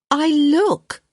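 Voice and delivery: high, loud protest. Acted. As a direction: object sharply in your own defence. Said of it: "Hyacinth protested".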